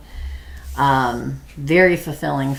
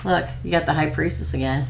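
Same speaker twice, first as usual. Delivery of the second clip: ordinary delivery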